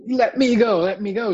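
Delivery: high, loud acted demand to be freed; Brer Rabbit stuck. Rabbit stuck in tar demands release, high and agitated. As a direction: struggle to get loose as a storybook rabbit.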